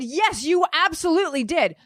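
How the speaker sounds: high, pressing accusation; insistent yelling. Retold or imitated. To the cart worker, a high insistent accusation. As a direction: refuse to let him deny it. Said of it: "yelling like this".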